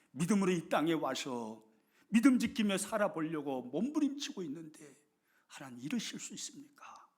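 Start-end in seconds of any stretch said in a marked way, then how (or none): 5.5-7.1 despairing whisper to God; whispered complaint. Acted. Villagers blaming God for the burnt houses, in a whisper. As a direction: hush it like a broken plea. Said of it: "하나님을 원망합니다".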